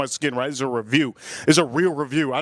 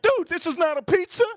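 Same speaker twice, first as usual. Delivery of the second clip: much higher squeaky disbelief; outraged self quote; 12 semitones higher than usual. Retold or imitated. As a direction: protest about a raw pizza in a mock high voice. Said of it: "I'm like".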